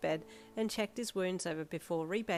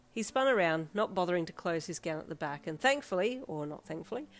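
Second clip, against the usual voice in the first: ordinary delivery, nothing marked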